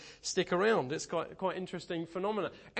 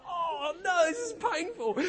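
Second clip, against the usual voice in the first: mock despair; 11 semitones higher, 5 dB louder, slower than usual. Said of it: "And we're like".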